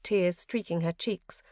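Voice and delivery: plain reading voice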